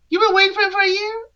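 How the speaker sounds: high, fast, mocking squeak of disbelief; sarcastic disbelief. Real, not acted. To Tia, scoffing at her claim of waiting a whole year, in a high mocking voice. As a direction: throw her own words back at her, incredulous.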